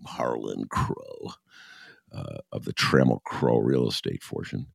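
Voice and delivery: ordinary delivery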